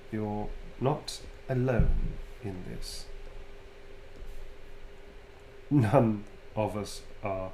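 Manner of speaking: soft and kind. Acted. Vampire comforting a friend in private, slow and soft. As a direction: say it softly, slowly, as if no one is listening. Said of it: "Something soft and kind as Starim might say".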